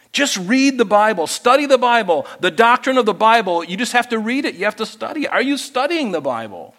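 preaching push. Real, not acted. Preaching exhortation.

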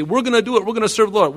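high, loud, overeager crowd voice; eager pledge. Acted. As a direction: pledge it with naive gusto, pitched right up. Acting out the people's eager pledge.

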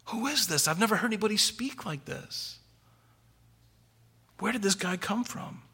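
soft, high, awestruck wonder; amazed disbelief. Acted. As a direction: gasp it out like stunned officers. Officers amazed at Jesus.

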